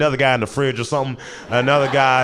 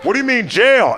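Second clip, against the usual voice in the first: calling out; 11 semitones higher than usual. Retold or imitated. Calling to drunk roommate.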